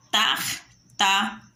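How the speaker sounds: "tartaruga" split TAR... TA... RU... GA; four detached syllables, tar / ta / ru / ga. Shown as a demonstration. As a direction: say "tartaruga" as four separate beats with pauses. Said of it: "Quatro sílabas".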